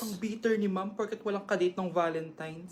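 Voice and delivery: low, soft snide aside behind the teacher's back; muttered aside. Acted. Student mocks the bitter teacher under her breath, low and soft. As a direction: mutter it so the teacher won't hear.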